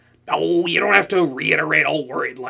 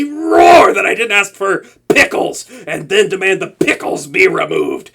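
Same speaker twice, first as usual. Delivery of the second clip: louder retold demand; mock roar; 3 semitones higher, 7 dB louder than usual. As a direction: imitate her booming complaint. Retelling the hero's pickle complaint.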